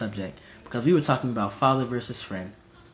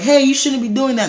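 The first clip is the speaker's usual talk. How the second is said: put-on high, louder little-kid protest; 10 semitones higher, 6 dB louder than usual. Little kid telling off a big bully, high protesting voice. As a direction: pipe up high, scolding the bully. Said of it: "The little kid's like".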